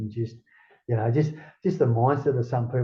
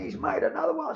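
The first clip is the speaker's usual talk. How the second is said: much higher, thrilled report; mock thrilled phone report; excited relay. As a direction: pass on the news all worked up. Retelling the friend's phone call.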